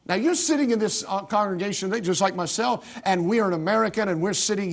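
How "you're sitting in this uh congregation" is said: usual speaking voice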